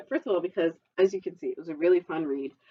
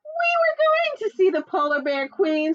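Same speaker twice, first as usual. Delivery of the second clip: high, loud chirpy bird voice; high little bird voice; 11 semitones higher, 6 dB louder than usual. Acted. Little bird explaining to the fox, high and loud. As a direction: pipe it up like a small bird. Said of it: "said Looney Little".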